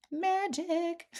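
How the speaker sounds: high, sing-song chant of magic; singsong magic. Retold or imitated. Her delighted reaction whenever the universe delivers, high singsong. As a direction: lilt it up high like a delighted little spell. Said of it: "that's what I call it".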